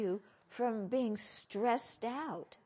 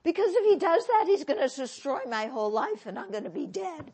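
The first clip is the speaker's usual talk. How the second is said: much higher and louder, fast, then laughing; hammed-up panic; 5 semitones higher, 10 dB louder than usual. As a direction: overblown dramatic self. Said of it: "inner angry voice, catastrophizing".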